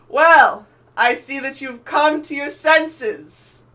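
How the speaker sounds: high, drawn-out shout to a distant ship; yelling across. Acted. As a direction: project loudly, stretching the words. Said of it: "he's like yelling across".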